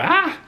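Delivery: excited high-pitched squeal; fan squeal. Retold or imitated. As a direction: shriek like a starstruck fan.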